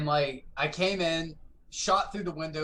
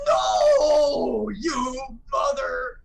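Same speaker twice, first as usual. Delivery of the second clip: much higher and louder, dragged-out outraged cry; furious shout; 11 semitones higher, 10 dB louder, much slower than usual. Retold or imitated. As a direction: yell at your killer in rage. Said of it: "and he goes".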